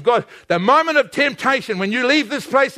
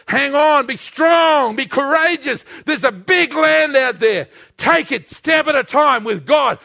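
raised, punchy preaching; rousing exhortation; 4 semitones higher than usual. Real, not acted. Exhorts the congregation.

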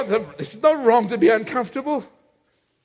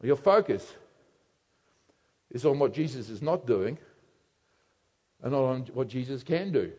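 very low, soft and measured; quiet, suspenseful sermon delivery; hushed preaching; 11 semitones lower, 10 dB quieter than usual. Real, not acted. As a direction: drop to a grave hush, with long pauses. Hushed preaching after the loud outburst.

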